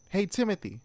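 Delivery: high-pitched call across the yard; yelled. A narrator's reading. Sister calling Timothy from the porch at night, yelled. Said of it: "I yelled".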